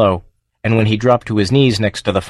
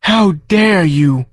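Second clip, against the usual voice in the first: high, very slow creature outrage; indignant demand; 8 semitones higher, much slower than usual. Acted. The farm confronting Joe over its trees, high slow outraged creature. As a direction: draw out the indignation. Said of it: "demanded the farm".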